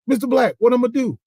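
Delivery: high, loud cry for advice; mock distressed plea. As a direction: imitate a kid urgently begging for help. Kid bringing mentor a crisis.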